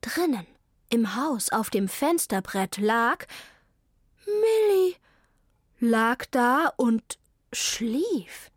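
usual speaking voice

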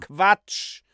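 sharp high dismissive call. Acted. Heinrich Lippenknabe waves off Euphemia's dream, loud high call. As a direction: fling the word out loud and high. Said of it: "rief Heinrich Lippenknabe".

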